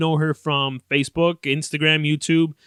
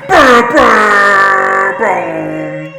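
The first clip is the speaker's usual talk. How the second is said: blaring, drawn-out horn noise; very loud vocal fanfare blasts; imitated air horn; 5 semitones higher, 14 dB louder, much slower than usual. Vlogger heralding big news, blaring horn imitation.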